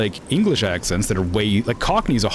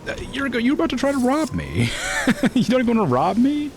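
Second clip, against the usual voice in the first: much higher, incredulous at the robber; indignant surprise. Real, not acted. Game thief tries to rob her, high incredulous outrage. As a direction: squeak out mock outrage at being robbed.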